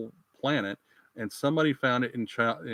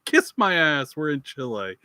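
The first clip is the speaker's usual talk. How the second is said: defiant retort; 4 semitones higher, 5 dB louder than usual. Acted. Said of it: "And they're like".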